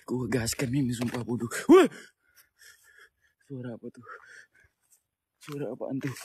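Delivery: hushed, low and frightened; scared horror game streamer. Real, not acted. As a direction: whisper it, spooked by a noise. Hears sound, hushed fear.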